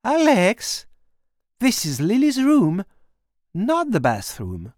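put-on mother finds the girl in her daughter's room, sharp and high; startled mother. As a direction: call her name sharply, then point out the room. Caroline catches her in room.

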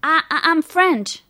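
urgent stammered English; flustered stammer. A narrator's reading. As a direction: stumble into it, pitch up. Flustered girl struggling in English.

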